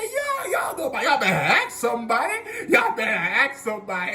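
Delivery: high, loud mock swagger; hammed-up bragging. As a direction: crow it like a boastful old teacher. Teacher brags to his class.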